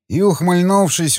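usual speaking voice

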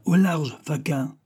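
high-pitched call to clear off; bellowed order. A narrator's reading. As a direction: send the lackeys packing, mildly shouted. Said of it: "avec des poumons si puissants". (ordre lancé à pleine voix)

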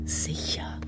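low soft whisper; whispered reassurance. Acted. Older brother reassuring frightened brother, whispered. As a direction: whisper it to calm him.

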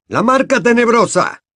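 high, loud breathless gasp; panting. Acted. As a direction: Ludo Bagman arriving out of breath, panting. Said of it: "dijo jadeando".

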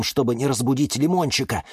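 plain reading voice

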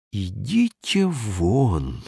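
very slow, held-back fury; slow restrained dismissal; restrained anger. A narrator's reading. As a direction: hold the anger in, slowly. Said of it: "сдержанно сказал я".

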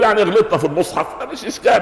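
high-pitched mock excuse with a laugh; dismissive excuse-maker. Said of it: "الممرضه تغلط، يقول".

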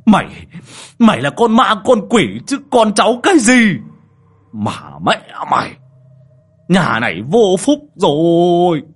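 loud, high-pitched angry tirade; furious cursing. Acted. Old man cursing by the pond, furious. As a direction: curse with full outrage, dragging out the last line. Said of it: "Đoạn ông đứng đấy chống nạnh mà chửi".

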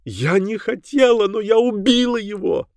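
high, drawn-out anguished wail; slow, high-pitched despair; hysterical cry. A narrator's reading. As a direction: wail in horror at yourself. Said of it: "закричала она".

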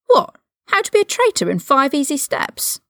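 high, scornful comeback; sarcastic retort. A narrator's reading. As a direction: throw his lesson back in his face. Ron's sarcastic retort to Lucius.

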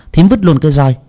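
ordinary delivery

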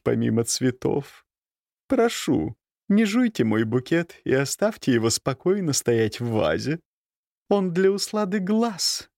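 a dry, mocking countess. A narrator's reading. Countess voiced in story.

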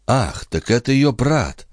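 usual speaking voice

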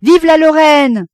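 high, loud, drawn-out hurrah; crowd's cheer. A narrator's reading. Crowds on piers cheer the departing liner, shouting. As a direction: cheer it slowly at full voice. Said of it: "se mit à crier".

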